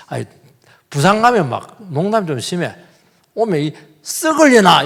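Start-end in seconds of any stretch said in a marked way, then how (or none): 4.0-4.8 loud, high Busan-style jibe; rough joke yell. Retold or imitated. A preacher quoting rough Busan banter, loud jibe. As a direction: fling it out as a teasing insult. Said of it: "이래 버리니까".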